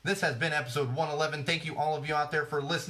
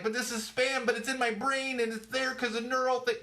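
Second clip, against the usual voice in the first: much higher, whiny confused voice; mock confused user; 11 semitones higher than usual. Imitating a future user stuck with brain spam, baffled and whiny. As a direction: whine it like a baffled user with spam in their head. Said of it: "You're gonna be like".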